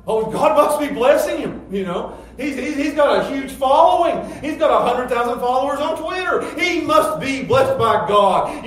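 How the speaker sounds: high, loud, mocking fervor; loud mock admiration. People raving about a preacher with a big crowd, loud mock admiration. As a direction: shout it like an over-impressed follower.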